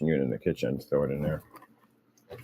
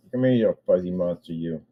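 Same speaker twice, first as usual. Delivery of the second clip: normal talking voice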